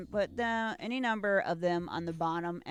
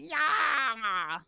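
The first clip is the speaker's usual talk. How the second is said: excited cheer; 6 semitones higher, 4 dB louder than usual. Retold or imitated. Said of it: "He's like".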